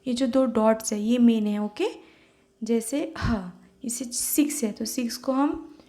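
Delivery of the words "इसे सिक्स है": ordinary talk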